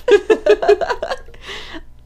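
loud high-pitched laugh. Real, not acted. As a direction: burst out laughing. Laughs at partner's joke.

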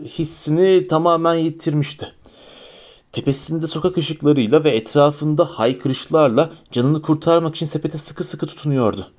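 ordinary delivery

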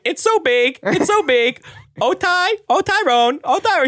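high squeaky mock moaning; hammed-up high bird voice; parrot mimicking wife. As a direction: echo a lover's cries like a parrot. Parrot mimicking wife's moans.

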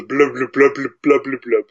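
wet gurgling blub noises; gurgling fish man croak. Retold or imitated. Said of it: "another voice that just goes".

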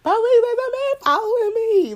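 high, loud mock yell for the police, half laughing; retold screaming for police. Woman screaming for police at the boyfriend following her. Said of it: "I started screaming".

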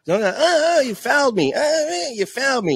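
high, mock whiny complaint; mock him crying foul; whiny LeBron. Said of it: "he's like".

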